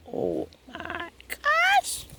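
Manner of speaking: very high, slow gasp of delight; drawn-out delighted gasp; amazed. Real, not acted. Sees sugar apples.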